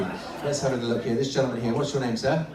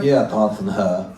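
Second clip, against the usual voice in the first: low, grudging husband puppet; put-on deep male character; man's dummy voice; 6 semitones lower than usual. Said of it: "What about a voice like this?".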